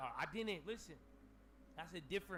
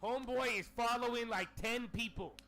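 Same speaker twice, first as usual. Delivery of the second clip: loud, raised voice, slow in the middle; retold rant; 6 semitones higher, 7 dB louder, slower than usual. Streamer replaying his outburst to viewers, loud and indignant. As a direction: rant it loudly to the chat. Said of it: "I said".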